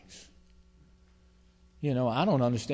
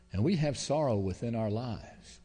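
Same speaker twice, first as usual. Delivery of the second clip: normal talking voice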